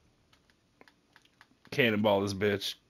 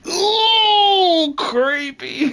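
mock spooky; 9 dB louder, much slower than usual.

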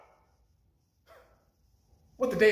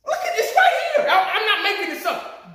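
laughing disbelief; 7 semitones higher than usual. Real, not acted. Laughs showing the quote.

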